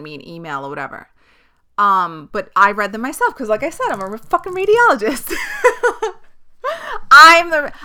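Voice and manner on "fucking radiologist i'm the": high squealing laugh through the words. Real, not acted. Mocking herself to listeners, laughing high. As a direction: crack up at your own joke. Joking she reads her own scans.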